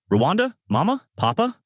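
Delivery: high, questioning call, name by name. A narrator's reading. Child wakes and looks for her family, calling out. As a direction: call out lightly for someone, rising on each name. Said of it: "comes out of her room calling".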